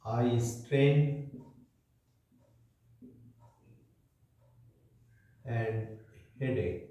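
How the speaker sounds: a word or two, then a long wait; unhurried, broken-up classroom dictation; slow dictation. Real, not acted. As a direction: dictate it piece by piece for note-takers. Dictating points for children to write.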